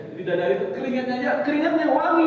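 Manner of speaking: building fast to a high, loud peak; rising wonder. Real, not acted. Building wonder at angels' sweat. (takjub yang memuncak)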